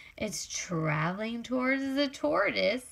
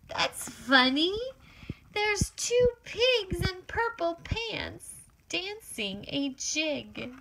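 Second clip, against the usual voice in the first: ordinary delivery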